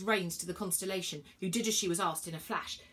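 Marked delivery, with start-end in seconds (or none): none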